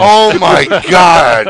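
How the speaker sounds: long, loud, amazed exclamation; real astonishment. Real, not acted. Host floored by the school story, astonished cry. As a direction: stretch every word in loud amazement. Stunned at the Chuck Norris name.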